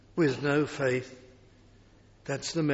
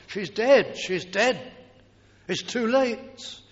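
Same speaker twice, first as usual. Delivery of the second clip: mourners' cry; 6 semitones higher, 4 dB louder than usual. Acted. Mourners wailing over dead girl.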